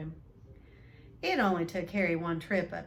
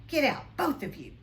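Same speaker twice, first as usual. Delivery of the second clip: croaked; 6 semitones higher, 6 dB louder than usual. Acted. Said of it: "croaked Uncle Vernon".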